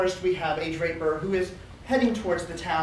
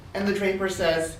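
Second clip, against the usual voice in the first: plain reading voice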